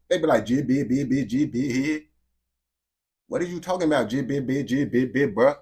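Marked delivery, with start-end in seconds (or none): 0.4-2.0 babbled imitation of Florida talk; mock Florida accent. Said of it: "people talk about how Florida people talk". 4.1-5.3 babbled imitation of Florida talk; mock Florida accent. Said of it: "people talk about how Florida people talk".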